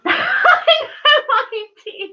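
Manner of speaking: high, loud, slow laugh through the words; laughing at her own nonsense; giggling, caught out. Real, not acted.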